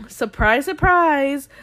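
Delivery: mock fanfare for the utterly predictable; sarcastic announcement. Real, not acted.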